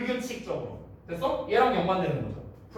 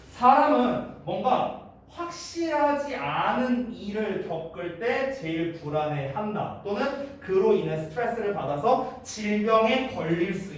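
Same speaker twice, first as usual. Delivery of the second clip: slow, deliberate model sentence; slow dictation; slower than usual. Shown as a demonstration. Teacher to students, dictating the summary sentence slowly. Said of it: "dictating summary slowly".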